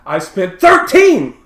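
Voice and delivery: hammed-up Will yelling about his absent father; acted outburst. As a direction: work up to yelling the last line. Retelling Will's emotional outburst.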